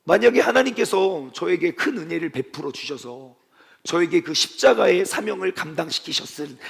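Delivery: ordinary delivery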